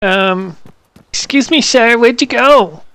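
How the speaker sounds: high-pitched morphed voice; squirrel voice. Acted. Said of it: "talk in this squirrel voice".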